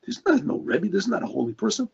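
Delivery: hushed, private muttering of doubt; inner thoughts. Retold or imitated. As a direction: think it aloud under your breath.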